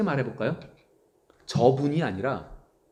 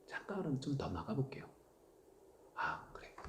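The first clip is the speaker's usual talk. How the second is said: whispered aside; 16 dB quieter than usual. Acted. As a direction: lean in and whisper. Hushed aside. (속삭이는 말투)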